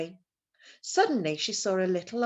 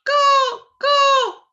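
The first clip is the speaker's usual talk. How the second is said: high, loud cawing like a crow; shrill bird cry in the forest; crow's caw; 14 dB louder than usual. A narrator's reading. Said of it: "She heard it again".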